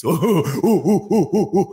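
rising ape hoots; mocking "uh-uh-uh" monkey calls; imitated orangutan hooting. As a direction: hoot like an excited orangutan. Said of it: "la nivelul unor orangutani care când se zice sex".

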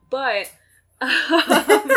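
breaks into a laugh. Real, not acted. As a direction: laugh through the filler. Laughs while talking.

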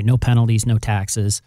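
ordinary talk, nothing marked

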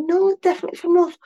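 high-pitched, mock protest; retold insistent denial. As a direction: act out setting someone straight, pitched high. Acting out own retold denial.

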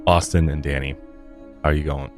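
normal talking voice

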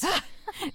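a sudden high burst of laughter; startled laugh. Acted. Pranked coworker laughing at the snake scare, high burst of laughter. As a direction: laugh in spite of yourself after the scare.

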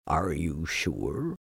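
low, quiet, glum donkey; gloomy donkey. Acted. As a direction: mope it out low and soft.

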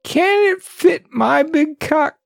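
hammed-up squeaky rodent king; shrill rat king. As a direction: screech at the rat like an outraged king. Rat King's question.

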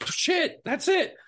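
very high-pitched mock eureka; excited approval. Director hearing the right accent, excited. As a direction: exclaim it as a thrilled discovery. Director's delighted reaction, retold.

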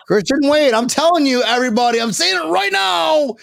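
high-pitched hype, like a bold prediction; excited proclamation. Real, not acted. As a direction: announce it to everyone, pumped up. Hyping his favourite player.

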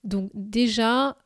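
ordinary delivery

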